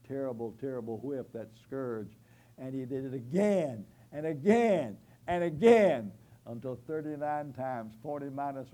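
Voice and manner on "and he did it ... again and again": rising to a loud preaching cry; preaching shout. Real, not acted. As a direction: hammer each again louder. Preacher driving home the scourging.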